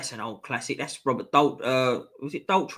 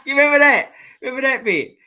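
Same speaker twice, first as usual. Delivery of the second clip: asked through laughter, louder and higher; laughing; 10 semitones higher, 8 dB louder, faster than usual. Real, not acted. Reminiscing with chat about a film scene, laughing. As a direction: laugh while asking it.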